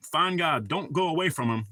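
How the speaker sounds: normal talking voice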